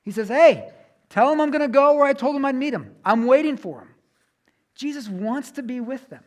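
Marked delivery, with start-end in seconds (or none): 0.3-3.9 eager message. Pretend. Jesus sends message to disciples.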